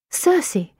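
Circe rising high in surprise; surprised. Acted. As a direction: echo Circe as a startled question. She echoes the strange name.

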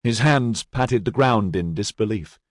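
ordinary delivery, nothing marked

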